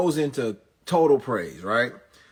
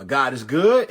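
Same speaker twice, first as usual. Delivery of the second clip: louder call to the congregation; preacher call; 3 semitones higher, 8 dB louder, slower than usual. Retold or imitated. Comedian calls out to the crowd, louder. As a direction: call it out for the response.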